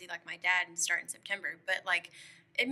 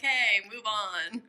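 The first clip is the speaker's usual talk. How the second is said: loud, drawn-out retort; mock exasperated call; 8 dB louder, much slower than usual. Woken woman answering him about the tree, loud mock dismissal. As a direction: call it out slowly to brush him off.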